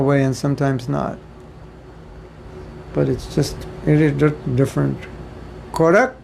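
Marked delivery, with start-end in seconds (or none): 2.9-6.2 playful Indian English Correct, kordek; mock Indian English question and answer; Indian English accent. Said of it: "That's what they say in India".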